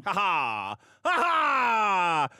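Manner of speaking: pirate laugh. Acted.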